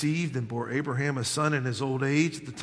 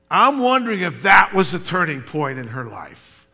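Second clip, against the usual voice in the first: high, loud sermon delivery, driving hard; full-force preaching; 8 semitones higher, 12 dB louder than usual. Real, not acted. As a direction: preach it with rising fire and big emphatic words.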